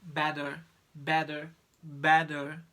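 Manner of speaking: repeated "better" with D-like tap, rising volume; flap T drill. Shown as a demonstration. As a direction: build up "better" with a flapped T. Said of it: "another example is".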